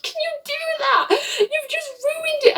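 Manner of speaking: very high, soft squeal of outrage; mock outrage. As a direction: imitate a friend losing it in a tiny, strangled high voice. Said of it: "she lost it on me".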